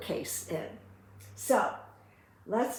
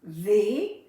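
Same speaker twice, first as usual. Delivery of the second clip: normal talking voice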